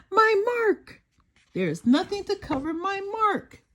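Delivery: sudden dread, nowhere to hide; panicked. A narrator's reading. Bunny realises her mark will show, panicked cry.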